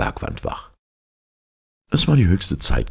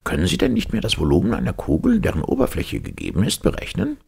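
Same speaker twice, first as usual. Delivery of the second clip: high, exacting professor voice; pedantic professor; 9 semitones higher than usual. Professor quizzing his former pupil, high and impatient. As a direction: quiz him like a testy old schoolmaster.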